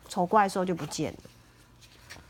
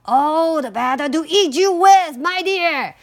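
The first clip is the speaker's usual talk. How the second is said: loud, high-pitched wolf about to pounce; wolf's menacing shout; 6 semitones higher, 8 dB louder than usual. A narrator's reading. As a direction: burst out loudly as the hungry wolf.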